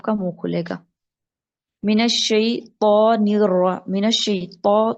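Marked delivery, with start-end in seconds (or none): 1.8-4.9 الشیطان with a thick, full ط, said slowly; heavy ṭa. Shown as a demonstration. Teacher modelling a heavy ط in الشیطان. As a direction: make the ط in الشیطان heavy and round. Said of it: "تو کو موٹا رکھنا ہے".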